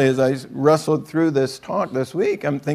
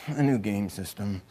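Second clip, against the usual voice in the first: mock reluctant whisper-soft; 7 semitones lower, 10 dB quieter than usual. Kid reluctantly admitting his new game system, soft grudging. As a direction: lower and softer, grudging admission.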